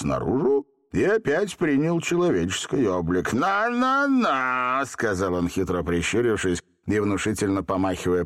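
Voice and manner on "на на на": drawn-out, high sing-song taunt; sly taunt. A narrator's reading. Genie wagging a finger at Seryozha, taunting. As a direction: tease with long stretched syllables.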